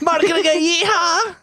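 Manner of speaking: high, fast, excited call-out; hype call. Acted. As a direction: shout it high and quick. Hyping co-hosts.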